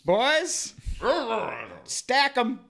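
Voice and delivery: put-on calling out high to the boys. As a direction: shout it up to the boys. Calling out to movers.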